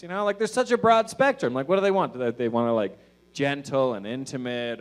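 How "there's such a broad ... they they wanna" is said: usual speaking voice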